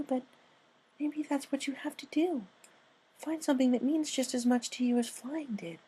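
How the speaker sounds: high, soft, timid pony voice; high soft shy pony; Fluttershy voice. Acted. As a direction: speak like a shy gentle mare.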